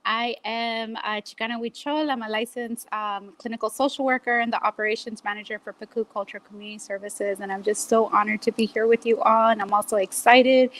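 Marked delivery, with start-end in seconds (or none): none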